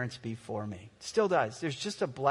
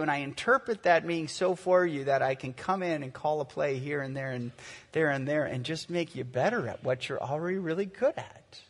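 ordinary talk

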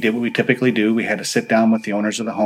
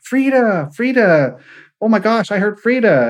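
higher, louder, overexcited retelling; retelling a woman's thrilled cry at hearing her name, higher and louder; excited outburst; 11 semitones higher, 4 dB louder than usual. Retold or imitated. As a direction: relive the moment she heard her name, thrilled. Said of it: "just started to have a fit".

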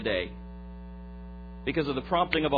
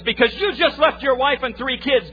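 high, loud retold accusation; accusing; 9 semitones higher, 7 dB louder than usual. Preacher voices a driver confronting a runaway husband, accusing. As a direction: confront him with raised pitch and volume.

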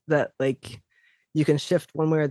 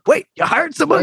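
dad voice; 13 semitones higher, 7 dB louder than usual. Retold or imitated. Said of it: "And my dad is like".

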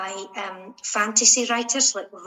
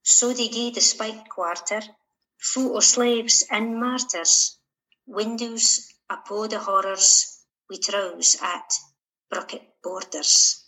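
poem read in Shetland dialect. Acted. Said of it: "in Shetland dialect".

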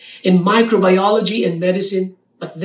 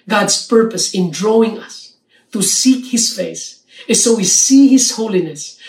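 normal talking voice

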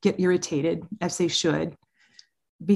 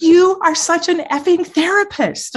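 much higher, louder mock outburst; hammed-up teen hurling an insult at his mom; imitating fed-up teen; 10 semitones higher, 7 dB louder than usual. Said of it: "he goes".

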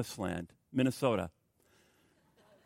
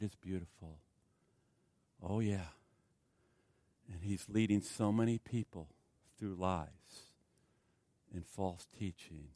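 hushed earnest warning; 8 semitones lower, 11 dB quieter than usual. Real, not acted.

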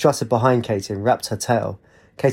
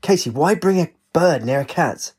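higher, exasperated question; mild shout; 5 semitones higher than usual. A narrator's reading. Wraith blames Katie for the bird, mild shout. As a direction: scold with lightly raised voice. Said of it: "Ray shouted".

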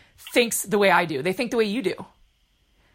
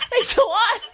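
much higher, louder laughing sarcasm; mock offended thanks; 5 dB louder than usual. Real, not acted. Daughter mock-thanks Mom for cutting her out, high and laughing. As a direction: squeal it in mock hurt.

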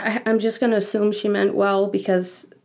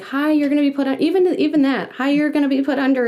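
higher-pitched mock announcement to a patient; mock bedside heads-up, pitched up; imagined polite notice; 6 semitones higher, 3 dB louder than usual. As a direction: imitate staff giving a friendly heads-up. Imagined notice nobody actually gave.

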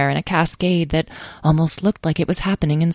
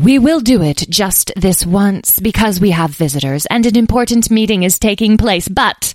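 high, loud, haughty fairy; commanding fairy, grandly raised voice; imperious; 6 semitones higher, 5 dB louder than usual. A narrator's reading. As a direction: lay down terms like royalty. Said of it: "said the one with the imperious tone".

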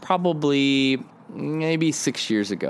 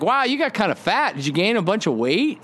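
high, tactless stranger's voice; blunt stranger; 8 semitones higher than usual. Retold or imitated. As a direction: blurt it out as the careless stranger, much higher.

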